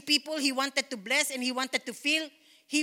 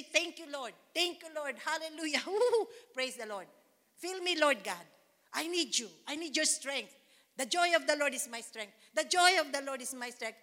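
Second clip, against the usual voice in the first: softer, murmured thanks and praise; soft praise prayer; 5 dB quieter than usual. Shown as a demonstration. Pastor showing how she prays each day, soft murmured praise. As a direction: pray it softly with a little laugh. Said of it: "if I don't take time to say".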